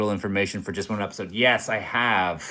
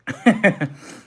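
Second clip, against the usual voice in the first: short high laugh; quick high-pitched laugh; 10 semitones higher, 3 dB louder than usual. Real, not acted. Laughs at own exaggeration.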